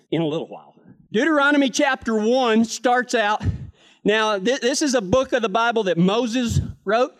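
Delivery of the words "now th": ordinary talk, nothing marked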